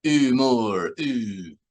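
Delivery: droning alien syllables, sliding downward; mumbling bug. A narrator's reading. Bug guard speaking through the wall, mumbled drone. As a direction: rumble vague sounds like a muffled insect. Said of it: "the words seemed mumbled".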